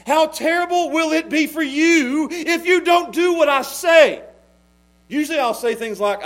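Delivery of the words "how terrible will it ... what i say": put-on father warning gaming kids; mock stern dad. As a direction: thunder it like a dad laying down the law. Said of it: "start saying these actual words".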